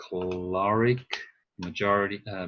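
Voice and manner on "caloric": caloric stressed on LOR; drawn-out caloric, weight on the middle syllable; stress on second syllable. Shown as a demonstration. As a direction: shift the emphasis to caLORic. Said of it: "the emphasis changed".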